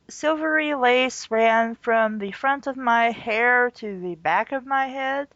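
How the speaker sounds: ordinary delivery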